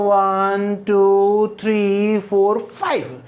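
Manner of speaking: slow count, वन to four held, five dropping at the end; rising then falling tone. Shown as a demonstration. Teacher demonstrating counting to five, drawn out with a falling close. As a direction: stretch each of वन, टू, three, four and let five fall. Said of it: "जैसे ही number खत्म होता है तो falling tone आती है".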